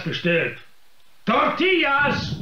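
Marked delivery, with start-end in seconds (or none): 1.3-2.4 impatient call. Acted. Impatient guest calls for food.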